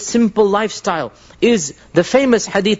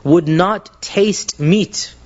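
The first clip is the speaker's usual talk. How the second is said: usual speaking voice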